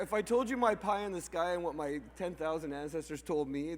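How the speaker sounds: usual speaking voice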